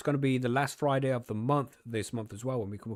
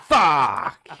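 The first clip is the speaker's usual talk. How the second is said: loud, drawn-out yell; shouted expletive; 3 semitones higher, 13 dB louder than usual. Real, not acted. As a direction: belt it out long and loud. Mock outburst amid group laughter.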